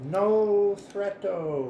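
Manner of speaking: slow, drawn out with a laugh; mock pidgin. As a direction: hands up, stretch each word. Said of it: "put both hands up and go".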